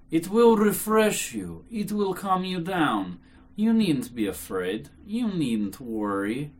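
slow, measured, soothing reassurance; extreme politeness. A narrator's reading. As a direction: reassure very politely, drawing the words out. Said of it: "with extreme politeness".

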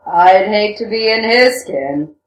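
slow, heavy character voice, hissed only in name; Rakitin, mock hiss. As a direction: draw it out in a put-on villain's voice. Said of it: "Rakitin hissed".

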